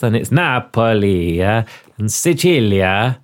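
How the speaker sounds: Napoli and Sicilia said the Italian way, NA-po-li and si-CHEE-lia; Italian pronunciation. Shown as a demonstration. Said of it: "This is written Sicily and Naples".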